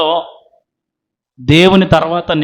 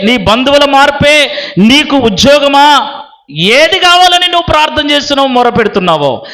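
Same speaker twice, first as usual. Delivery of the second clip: raised, insistent sermon voice; impassioned preaching; 6 semitones higher, 3 dB louder than usual. Acted. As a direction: build a driving list for the congregation. (ఆవేశంగా ప్రసంగించే స్వరం)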